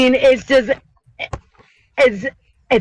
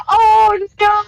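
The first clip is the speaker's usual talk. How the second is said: squeaky, drawn-out mock dismay, much higher; high squeal; 12 semitones higher, slower than usual. Watching Ethan dressed as Uncle Fester, a high slow squeal. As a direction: squeal it slowly in pity. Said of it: "I was just like".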